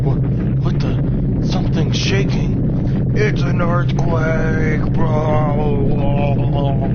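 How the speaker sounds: yelling in panic; alarmed shout. Acted. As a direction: shout over the quake. Sudden earthquake shaking.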